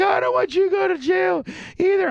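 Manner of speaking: very high falsetto wail; hammed-up tearful pleading; mock crying Erica. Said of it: "Well, then quit crying".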